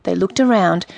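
ordinary delivery

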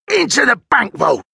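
high, effortful character voice; labouring crook, pitched well up; older thief at work. A narrator's reading. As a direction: talk in bursts between pickaxe blows. Older thief talks between pickaxe swings.

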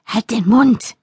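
mildly growled command. A narrator's reading. Woman silencing the voice in her head, mild growl. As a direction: snap back with restrained irritation. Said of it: "knurrte ich".

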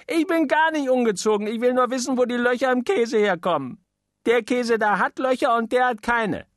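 put-on high little-boy voice, pestering; a small boy nagging his mother at the table; whiny child. Little boy pestering his mama at dinner, high child voice.

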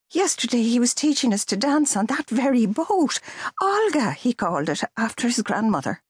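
lightly voiced girl, upset; young woman's voice. A narrator's reading. Girl in shock, higher voice.

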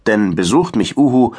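ordinary delivery, nothing marked

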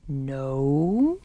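low, drawn-out, hesitant no; slow. A narrator's reading. Papa hesitates before correcting his children, slowly. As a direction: stretch the word out slowly, voice low. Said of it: "said Papa slowly".

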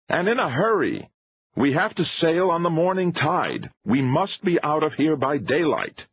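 high-pitched, pressing urgency; impatient filmmaker giving orders; urgent showman. A narrator's reading.

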